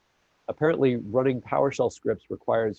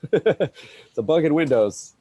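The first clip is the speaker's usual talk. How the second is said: amused joke; 6 semitones higher, 7 dB louder, slower than usual. Real, not acted. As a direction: laugh first, then a higher, louder punchline. Joking about blaming Windows.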